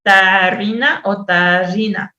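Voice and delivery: slow, "Tarrina" with a tap and then with a long trilled rr; exaggerated rolled R. Shown as a demonstration. Teacher drills the rr in "tarrina" for discrimination, slowly. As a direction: stretch the rr of "tarrina". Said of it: "hasta una exageración en el sonido".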